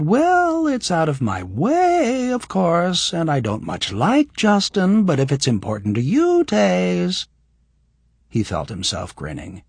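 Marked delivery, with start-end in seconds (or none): 0.0-7.3 mocking imitation. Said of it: "And I said".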